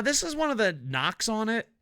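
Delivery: ordinary delivery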